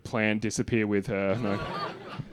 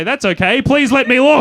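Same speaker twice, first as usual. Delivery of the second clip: much louder and higher, pleading; desperate plea; 11 semitones higher, 15 dB louder than usual. Retold or imitated. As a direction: beg to be let in, almost shouting. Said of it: "I say".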